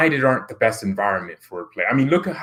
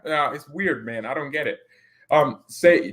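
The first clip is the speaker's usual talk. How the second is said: normal talking voice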